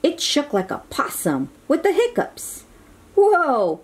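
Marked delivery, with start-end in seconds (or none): 3.1-3.8 higher startled whoa; mild cry of alarm; lightly voiced startled cowboy. A narrator's reading. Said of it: "Woody cried".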